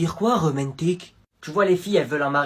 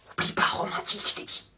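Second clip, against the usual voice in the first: whisper; 4 semitones higher, 8 dB quieter than usual. Acted. Friend whispering reminder during call.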